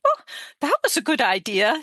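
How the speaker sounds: put-on a mild, friendly British-accented reply. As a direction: imitate the British friend politely. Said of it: "She's, she's British, British accent".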